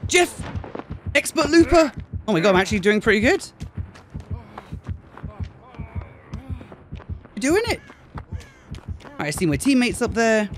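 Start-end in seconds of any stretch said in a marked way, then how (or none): 0.1-7.8 excited cheering. Real, not acted. Celebrating his successful chase.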